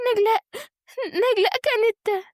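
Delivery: high, halting sobbed words; tearful breakdown. A narrator's reading. Wife struggles to name her attacker, distraught. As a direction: falter and leave it unfinished. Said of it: "وهي بتبكي ومنهارة".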